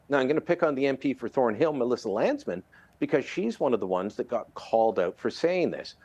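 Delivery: ordinary talk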